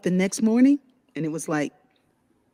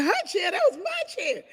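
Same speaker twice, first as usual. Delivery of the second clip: amused disbelief; 12 semitones higher, 4 dB quieter than usual. Retold or imitated. Incredulous inner reaction, laughing.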